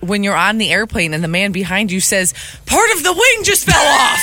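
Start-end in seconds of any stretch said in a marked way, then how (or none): 2.6-4.2 high, loud alarmed cry; panicked yell. Retold or imitated. Retelling panicked fellow passenger.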